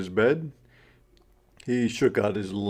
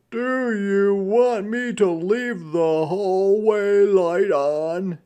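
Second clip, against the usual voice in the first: higher, louder fatherly question; dad asking his son at bedtime, higher and louder; Dad's voice; 8 semitones higher, 5 dB louder than usual. Acted. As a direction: ask kindly at bedtime. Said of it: "asked Dad".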